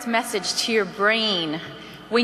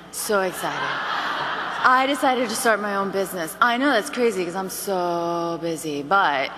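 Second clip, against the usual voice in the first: low-energy phone call, sagging at phrase ends; tired and unenthusiastic; 3 semitones lower than usual. Shown as a demonstration. Demo of a weary consultant phoning a friend, listless. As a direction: drag through the pitch as if worn out. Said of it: "you sound like this".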